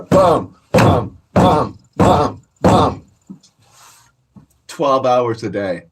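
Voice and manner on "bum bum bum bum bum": loud thudding bum bum; door kicking noise. Retold or imitated. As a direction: pound out the door kicks with your voice. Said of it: "I just sit there kicking the door".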